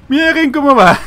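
very high, loud delighted squeal; hysterical amusement. Real, not acted. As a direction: shriek it on the verge of laughter. Repeats it, bursting into laughter.

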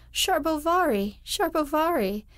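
high, loud jeering chant of the name; mocking yell. A narrator's reading. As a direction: yell it back at him like a mocking crowd. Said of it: "They yelled".

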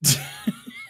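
silent wheeze of laughter; breathless high-pitched laugh; high wheezing laugh. Real, not acted. Big laugh at robot joke.

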